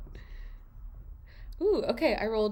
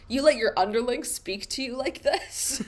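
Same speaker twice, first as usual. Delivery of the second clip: loud, higher shocked question; alarmed; 5 semitones higher, 5 dB louder than usual. Acted. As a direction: scold with disbelief. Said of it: "alarm at Megan's brash behavior".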